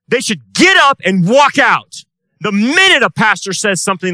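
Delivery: loud, high, forceful sermon voice; fiery preaching. Acted. Pastor denouncing the town's pastors, loud preaching. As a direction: thunder it at the pews.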